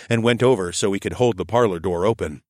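ordinary delivery

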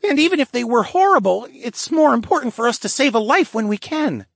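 put-on high, loud boyish voice; a kid making his case, pitched high; earnest, high-pitched young character; earnest lynx boy. A narrator's reading.

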